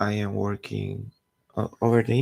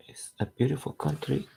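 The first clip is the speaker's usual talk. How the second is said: unvoiced stage whisper on "beautiful"; breathy whispered repeat of the praise; 4 semitones higher, 4 dB quieter than usual. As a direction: hush it like a secret aside.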